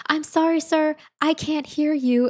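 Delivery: high, loud fake-polite customer service voice; mock polite manager. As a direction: sweetly pretend you can't hear.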